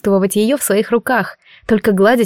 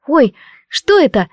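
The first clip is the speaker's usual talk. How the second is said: surprised; 5 semitones higher than usual. A narrator's reading. Daughter spots the parcel.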